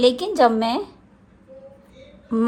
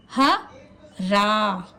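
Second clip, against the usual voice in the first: ordinary talk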